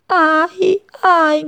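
high, loud little ouch; chick crying out. A narrator's reading. Chick cries out as the string is ripped off, high yelp. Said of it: "gridò C P".

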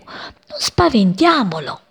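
lightly voiced creeping children; children sneaking.

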